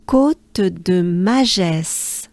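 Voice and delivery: Côte de Magès drawn out slowly, every vowel open; slow demo. Shown as a demonstration. Teacher slowly models the climb's name for learners. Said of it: "it goes like this".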